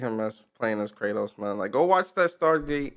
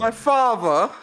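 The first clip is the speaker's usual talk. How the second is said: put-on kid voice; 11 semitones higher, 6 dB louder, slower than usual. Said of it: "Tom Holland as Atreus".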